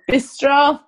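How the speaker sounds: rally a friend, be strong. Acted.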